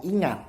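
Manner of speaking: "ingat" punched high and loud; emphasis on INGAT. Shown as a demonstration. Teacher repeats the key word, stressing "ingat" loudly. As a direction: hit "ingat" hard, then drop. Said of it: "ditekankan ketika ngomong".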